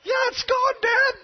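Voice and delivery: put-on very high, loud kid's shout; imitated excited child. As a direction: squeal it out like a thrilled little boy. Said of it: "He was excited".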